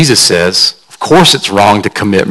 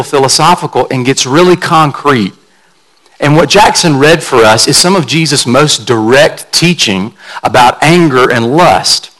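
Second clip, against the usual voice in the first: usual speaking voice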